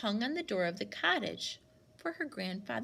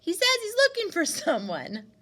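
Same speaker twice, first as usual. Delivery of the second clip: loud, raised voice across the yard; calling back; 9 semitones higher, 7 dB louder than usual. Acted. Winnie telling Granny about the man, loud call. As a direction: keep calling to the house. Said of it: "she called back".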